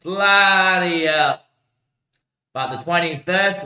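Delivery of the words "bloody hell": long, slow swearing; stretched exclamation of disbelief; drawn-out exclamation. Real, not acted. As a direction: draw the words right out.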